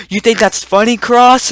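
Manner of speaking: high, loud mock confrontation; indignant retold telling-off; imitated angry teacher. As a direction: call the student out like an offended teacher. Teacher singles out laughing student.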